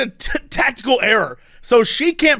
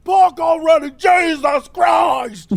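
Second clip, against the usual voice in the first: screaming guy; 7 semitones higher, slower than usual. Retold or imitated. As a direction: yell it like a fed-up driver. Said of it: "a guy in the back screams like".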